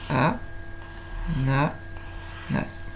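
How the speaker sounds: "A, na, nas" slowly, with long gaps; "A, na, nas" spaced out one syllable at a time. Shown as a demonstration. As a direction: separate "A, na, nas" into three beats. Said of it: "je vais faire trois ponts".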